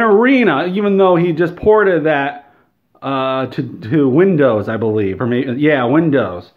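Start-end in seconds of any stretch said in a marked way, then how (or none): none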